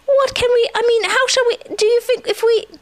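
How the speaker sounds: high, flustered character voice; distressed. A narrator's reading. Pooh wonders how to help Eeyore, in distress. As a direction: stumble along in a high fluster. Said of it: "said Pooh in distress".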